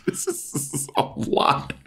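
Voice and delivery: soft, slow whisper; drawn-out, voiceless retold reaction; hushed shock. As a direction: whisper it as a stunned aside. Acts out shocked aside.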